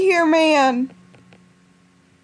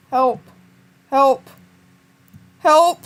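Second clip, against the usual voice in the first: calling for help; 8 dB louder, slower than usual. Real, not acted.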